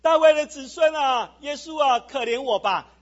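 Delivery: loud, drawn-out call for mercy; crying out. Acted. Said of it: "就喊着说".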